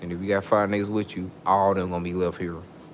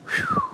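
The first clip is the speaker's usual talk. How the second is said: a sharp, very high whew; 3 dB louder than usual. Real, not acted. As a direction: blow off steam with a shrill-pitched whew. Heated rant peaks.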